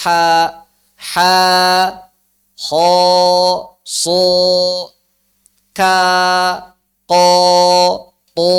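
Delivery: ha and ka thin, ho, so, ko, to thick and rounded; thin and thick letters. Shown as a demonstration. Teacher contrasts thin and thick letters for students. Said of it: "Beda suaranya".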